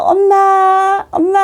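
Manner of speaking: high, drawn-out plea of "mommy"; child calling mom. Acted. Child to mother from her arms, tender high calling. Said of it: "정말 엄마 품에서".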